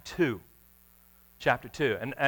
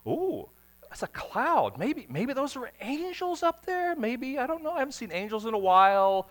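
preacher mocking shepherds unimpressed by the light; mock casual shepherds; 10 semitones higher than usual. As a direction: toss it off as if nothing special. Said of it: "sky and saying".

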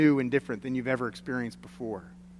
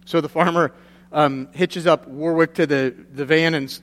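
ordinary talk

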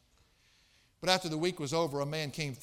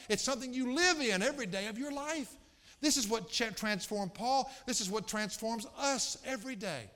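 higher-pitched fervent pulpit delivery; raised, urgent preacher's voice; impassioned preaching; 7 semitones higher, 3 dB louder than usual. Real, not acted. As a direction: preach it with rising fervor, stretching key words. Preacher builds in fervor.

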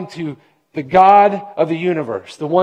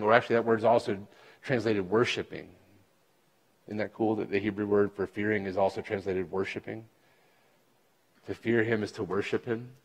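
much softer and lower, intimate aside; quiet; 9 semitones lower, 11 dB quieter than usual. Real, not acted. Preacher sharing a tender insight, soft and low. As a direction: drop down low and soft to share it.

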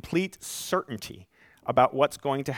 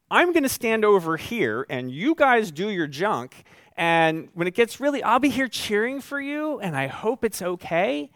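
mock aloof cheerleader; 7 semitones higher, 3 dB louder than usual. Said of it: "At no point does he say".

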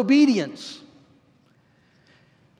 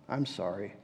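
barely audible, swallowed "I'm sorry."; mumbled apology; 15 dB quieter than usual. Shown as a demonstration. A child caught in wrongdoing mumbles a grudging apology. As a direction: mutter "I'm sorry." low and almost inaudibly. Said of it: "with a mumbled apology".

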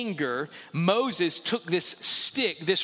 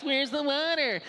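high, whiny mock question; mocking the whiners; 8 semitones higher than usual. Preacher mimics Israelites asking for water, mock whine. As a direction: imitate the people nagging for water. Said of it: "the first question you guys ask".

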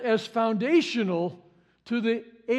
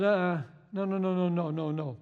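dismissive no; 4 semitones lower, 5 dB quieter, faster than usual. Acted. Paul refuting the circumcision party.

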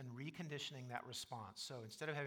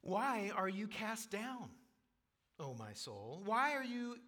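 higher, louder, slow anguished question; psalmist pleading with his soul; 7 semitones higher, 6 dB louder, slower than usual. Acted. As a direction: plead with your own soul. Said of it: "Look at verse five".

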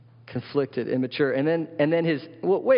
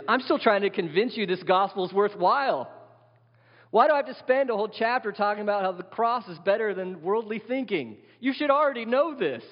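much higher, pleading and exasperated; exasperated Paul; 9 semitones higher than usual. Retold or imitated. Paul chiding the Corinthians, exasperated and high. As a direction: scold them like a fed-up teacher.

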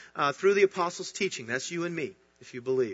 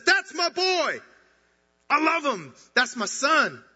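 much higher and louder, bursting with pride; a father who can't hold back his joy, shouting it to the heavens; bursting proud shout; 9 semitones higher, 6 dB louder, slower than usual. Retold or imitated. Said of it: "He rips apart the heavens and says".

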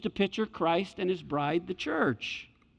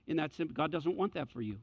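soft sincere appeal; 3 semitones lower, 8 dB quieter than usual. Real, not acted. Pastoral appeal, softer.

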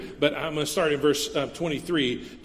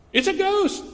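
much higher, scared cry of a disciple; frightened disciple; 13 semitones higher than usual. Acted. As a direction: yelp it like someone seeing a ghost.

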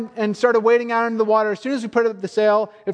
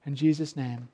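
prayer; 4 semitones lower than usual. Acted. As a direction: pray gently over the congregation. Closing prayer.

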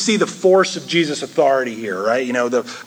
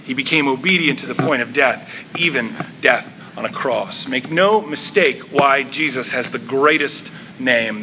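normal talking voice

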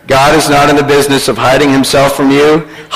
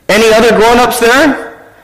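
lightly acted higher-pitched caller; telemarketer; 8 semitones higher than usual. Telemarketer questioning a small boy, lightly acted higher voice. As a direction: coax a small child with a question. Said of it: "A telemarketer".

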